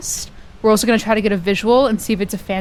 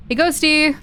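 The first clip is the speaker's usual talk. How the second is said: high sing-song call; 7 semitones higher than usual. Real, not acted. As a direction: call out to the ghost. Calling the ghost out loud.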